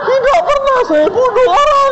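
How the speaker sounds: very high squealed denial; hammed-up terrified student; mock tearful panic. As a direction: plead innocence in a comic wail.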